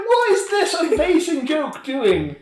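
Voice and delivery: amused disbelief. Retold or imitated. Incredulous reaction to the film's joke.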